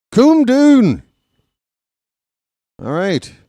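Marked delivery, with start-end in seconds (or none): 0.1-1.0 very high, loud mock-Irish cry; Irish accent. Commentator repeating the movie lady's line, louder Irish accent. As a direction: wail it out like an Irish lady. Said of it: "the way she said it".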